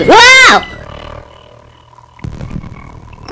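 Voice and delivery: sudden high shocked cry; jolted by a jump scare; startled yell. Real, not acted. Player jumps at the game's scare and cries out in shock.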